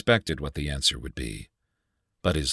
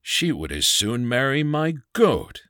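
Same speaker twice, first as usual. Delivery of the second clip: higher, louder jeering uncle; mocking howl; 9 semitones higher, 3 dB louder than usual. A narrator's reading. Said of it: "howled his uncle".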